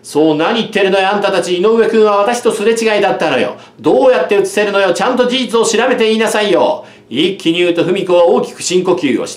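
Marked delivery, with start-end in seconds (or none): 0.0-6.8 higher indignant scolding; girl defending Kozo against the bullies. A narrator's reading. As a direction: tell them off in one breath. Fumiko scolds the boys.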